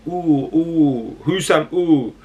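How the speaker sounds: playful, stretched-out "ooh" catchphrase impression. Fan jokes about saying a player's name, imitating an MC's ad-lib. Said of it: "I feel like D double E".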